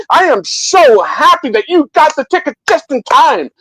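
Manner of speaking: put-on high, rushed, gushing host greeting the kids; overexcited Willy Wonka. Retelling the book, Wonka greets each kid in a high, gushing rush. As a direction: crank the pitch way up and gush. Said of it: "super, super excited to see them".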